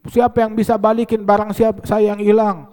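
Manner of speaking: half-strength mock yell; retold shouting. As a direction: imitate someone calling out in the market. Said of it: "ada orang jerit-jerit sekencengnya".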